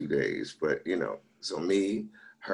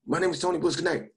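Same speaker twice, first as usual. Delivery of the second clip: high-pitched goodnight to a crowd; raised, urgent comic's sign-off; hurried sign-off; 12 semitones higher, 3 dB louder, faster than usual. Retold or imitated. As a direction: blurt out an abrupt stage exit. Retold abrupt stage sign-off.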